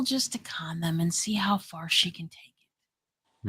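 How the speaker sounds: unvoiced confiding whisper. Real, not acted. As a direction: whisper it like a secret. Confiding about a con artist.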